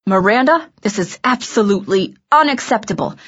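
indignant scolding, held in check; restrained fury. A narrator's reading. Director scolding his lead before curtain, restrained fury. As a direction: scold while holding back anger. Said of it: "trying to restrain himself".

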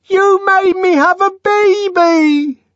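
very high, loud, slow scream. A narrator's reading. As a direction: scream it over the crowd. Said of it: "she screamed above the uproar".

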